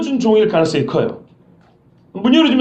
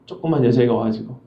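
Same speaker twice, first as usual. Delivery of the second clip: normal talking voice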